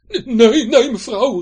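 high, loud stammering plea; frightened Hendrik. Acted. Hendrik begging Betje not to drop anchor, frightened. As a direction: beg her in a panic. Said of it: "riep Hendrik angstig".

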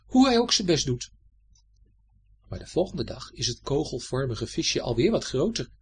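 ordinary delivery

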